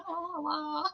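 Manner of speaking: high, soft and airy; mock fancy. Showing off her van's leather seats, soft and high. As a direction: show off the van with soft swagger. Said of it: "And I'm like".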